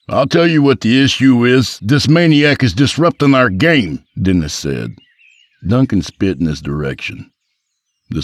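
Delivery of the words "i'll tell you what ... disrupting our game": raised, loud indignation; indignant complaint. Acted. Golfer complains to guard, raised indignant voice. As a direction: report the troublemaker, loudly indignant.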